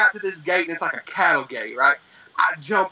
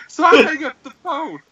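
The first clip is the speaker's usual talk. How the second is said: usual speaking voice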